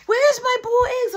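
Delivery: put-on high, loud child's voice; demanding kid. Little Boo Man demanding his boiled eggs from his sister.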